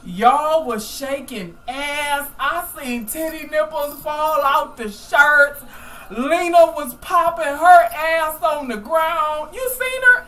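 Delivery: hyped up. Real, not acted. Hyped retelling of the show.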